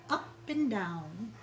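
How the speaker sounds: ordinary delivery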